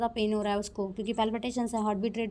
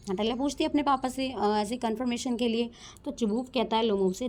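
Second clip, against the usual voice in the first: ordinary delivery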